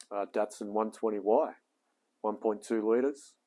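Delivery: ordinary delivery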